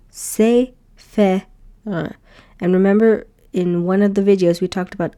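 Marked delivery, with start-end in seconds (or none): 0.1-2.1 slow syllable by syllable; teacher sounding out a word. Shown as a demonstration. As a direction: chain say fe r slowly. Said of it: "So you go".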